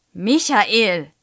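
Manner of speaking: loud, cross reprimand by name. A narrator's reading. Teacher to laughing pupil, cross. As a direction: call the boy to order angrily. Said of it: "sagt Frau Schröder böse".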